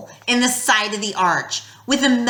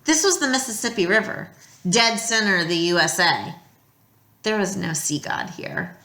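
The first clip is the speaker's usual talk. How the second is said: usual speaking voice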